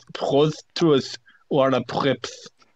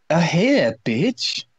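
emo voice; 5 semitones higher, much slower than usual. Acted. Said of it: "said in an emo voice".

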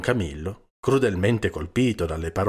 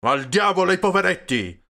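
high, furious shout; loud, enraged dismissal; beside himself, yelling; 10 semitones higher, 7 dB louder than usual. A narrator's reading. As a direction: explode in rage. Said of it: "urlò fuori di sé Peppone".

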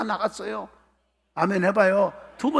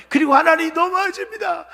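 high, loud complaint to heaven; acted resentful outcry; 9 semitones higher, 7 dB louder than usual. Preacher plays sufferer blaming God, resentful outcry. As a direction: wail it out in protest, hammed-up.

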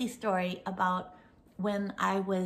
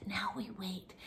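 soft hushed whisper; 10 dB quieter than usual. Retold or imitated. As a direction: whisper like a child hiding. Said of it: "she said".

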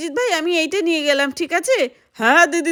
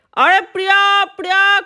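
calling out; 5 semitones higher, 5 dB louder than usual. Acted. Calling for lost friend.

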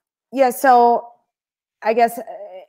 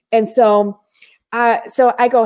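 usual speaking voice

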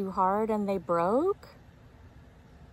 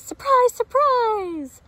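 high, loud happy cry; excited shout; 10 dB louder than usual. A narrator's reading. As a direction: shout it out with joy, much higher. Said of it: "Little Chick says".

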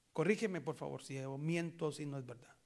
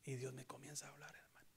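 whisper; 2 semitones lower, 10 dB quieter, faster than usual. Real, not acted. Whispered testimony.

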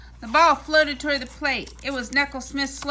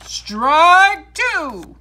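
loud, higher umpire bellow; umpire's call; 6 semitones higher, 11 dB louder than usual. A narrator's reading. The umpire calls the first strike, a loud high bellow. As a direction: call it out across the field. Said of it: "shouted the umpire".

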